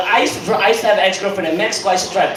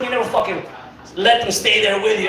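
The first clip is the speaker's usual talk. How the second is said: ordinary talk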